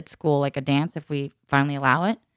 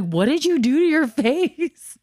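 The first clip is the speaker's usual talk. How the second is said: shocked mom; 10 semitones higher, 4 dB louder, slower than usual. Retold or imitated. Mom shocked at daughter's eyebrows.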